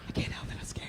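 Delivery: frightened whisper. Acted. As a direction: whisper while hiding. Victim hiding from killer.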